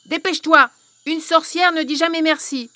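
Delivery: loud impatient hag; urgent witch. Acted. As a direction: hurry her, stuck and panicking. Witch refuses to thank.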